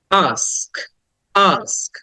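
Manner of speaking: "ask" with an open drawn-out "আ"; long open vowel. Shown as a demonstration. Teacher modelling the next word, long open "আ" in "ask". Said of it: "আটা long করে বলতে হবে".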